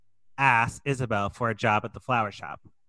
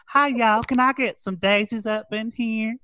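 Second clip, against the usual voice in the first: put-on Southern accent; 9 semitones higher than usual. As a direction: put on a hammy Southern accent for a phone order. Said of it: "she just calls with the Southern accent".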